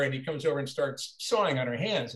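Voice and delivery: ordinary talk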